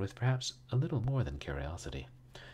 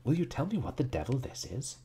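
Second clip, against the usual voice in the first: higher-pitched, impatient outburst; bursting out; 8 semitones higher, 4 dB louder than usual. A narrator's reading. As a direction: burst out with the question. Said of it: "he burst out".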